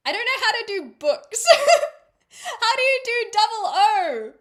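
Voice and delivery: high, loud, laughing exasperation; exasperated outburst. Real, not acted. Streamer stuck on one Irish word, loud laughing exasperation. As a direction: cry out helplessly, laughing at your own failure. Comic exasperation at failed accent.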